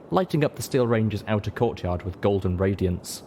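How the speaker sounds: plain reading voice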